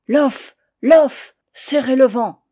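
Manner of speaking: cris de barre, hauts et très forts; ordre crié. Acted. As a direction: hurler la manœuvre au vent. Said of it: "nous criait". (le père crie la manœuvre à ses fils)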